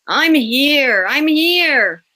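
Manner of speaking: loud, high, off-timbre sleepy call; groggy arrival. A narrator's reading. Victor stumbling into the office late, loud groggy call. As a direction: stumble in half-awake, announcing yourself.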